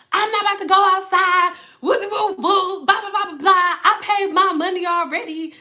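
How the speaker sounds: high-pitched, blustering protest; mock indignant refusal. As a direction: imitate a customer ranting back, blah-blahing through it. Retold customer arguing and refusing.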